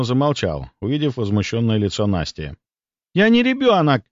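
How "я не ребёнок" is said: put-on high, indignant girl's outburst; bristle, pitched well up; flaring up. A narrator's reading. Girl objects to being called a child, flaring up. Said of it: "взвилась она".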